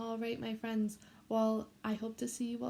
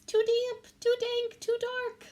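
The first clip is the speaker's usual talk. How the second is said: much higher, louder squeak; high little mouse voice; squeaky mouse; 12 semitones higher, 6 dB louder, slower than usual. Acted. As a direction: squeak it out like a tiny fussy mouse. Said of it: "Mouse squeaks".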